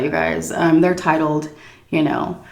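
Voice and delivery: ordinary talk, nothing marked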